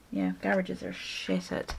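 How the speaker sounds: usual speaking voice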